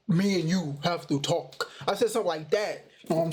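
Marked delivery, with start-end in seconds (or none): 0.1-1.7 lightly muffled, mask-voiced Vader imitation; a hint of Darth Vader talking through the mask; speaking through a Darth Vader mask, lightly imitated. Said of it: "It sounded like".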